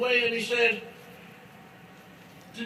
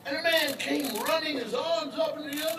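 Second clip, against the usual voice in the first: usual speaking voice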